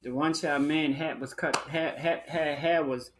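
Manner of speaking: ordinary talk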